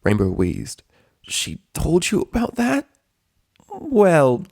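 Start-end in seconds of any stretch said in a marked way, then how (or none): 1.2-2.9 high, slow, shocked; Rainbow voice. Acted. Injured Rainbow asks Rarity, stunned and high. 3.7-4.5 high, loud hesitant well; a flustered drawn-out well; Rarity voice. Acted. Rarity caught out by Rainbow, flustered.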